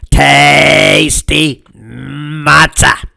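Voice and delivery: drawn-out, very slow goat voice; slow munching goat. Acted. Gray goat at the Seder table, slow munching voice. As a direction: chew the words like a goat between bites. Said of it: "said the gray goat between bites".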